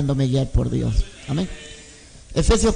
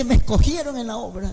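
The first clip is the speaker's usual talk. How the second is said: put-on proud child; 6 semitones higher than usual. Niño feliz da la noticia.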